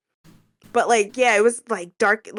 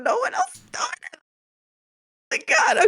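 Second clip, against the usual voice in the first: high-pitched, fast fangirl squeal; excited gushing; 13 semitones higher, faster than usual. Real, not acted. Gushing over the group's vocals.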